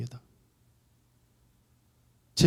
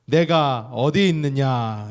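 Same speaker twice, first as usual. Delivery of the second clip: slow, drawn-out louder call; voice of God calling; 3 semitones higher, 8 dB louder, much slower than usual. Acted. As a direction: stretch God's question out, searching. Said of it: "이렇게 말씀합니다".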